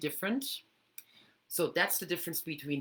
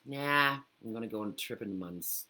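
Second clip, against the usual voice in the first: bored and unexcited; 4 semitones lower than usual. Shown as a demonstration. Brushing off an upcoming trip, flat and bored. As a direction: toss it off like it barely matters. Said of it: "so not excited".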